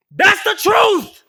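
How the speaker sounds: high, loud and slow proclamation; real shouted sermon-like cry; shouting. Real, not acted. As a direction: preach it at full force. Rant peaks, shouted declaration.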